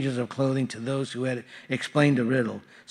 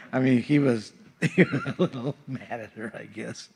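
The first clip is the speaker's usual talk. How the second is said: chuckling while he explains; laughing. Real, not acted. Pastor to congregation, laughing at the joke. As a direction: laugh through the aside.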